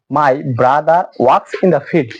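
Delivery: "brother works" very slowly, the r of "brother" sounded; linking r demo. Shown as a demonstration. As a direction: spell out "my brother works in the field" slowly with the r pronounced. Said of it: "এখানে আরটা উচ্চারিত হচ্ছে". (খুব ধীরে, "brother"-এর আর উচ্চারণ করে)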